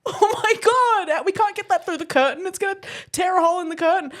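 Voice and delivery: high, hammed-up alarm over a giant pin; mock panic. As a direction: shriek it out in pretend panic. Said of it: "We were like".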